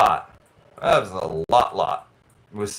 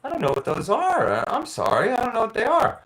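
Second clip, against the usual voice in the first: apologetic clerk; 10 semitones higher than usual. Retold or imitated. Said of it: "she goes".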